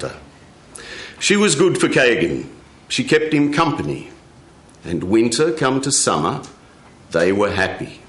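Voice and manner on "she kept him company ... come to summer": usual speaking voice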